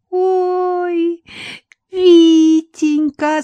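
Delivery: put-on high, drawn-out old woman's cry of surprise; creaky amazed old woman. A narrator's reading. Old woman greets Viktor, drawn out in amazement. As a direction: stretch it out like a delighted old granny. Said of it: "скрипучим голосом изумлённо протянула".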